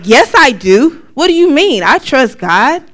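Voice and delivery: mock-indignant denial; loud defensive protest. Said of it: "I was like".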